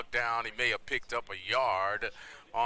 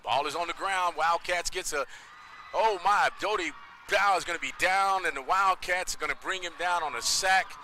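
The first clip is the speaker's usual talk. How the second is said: raised, louder call of a sudden turnover; excited play-by-play; 5 semitones higher, 5 dB louder than usual. Real, not acted. Announcer calls loose ball and sack, excited play-by-play. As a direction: call the broken play with urgency.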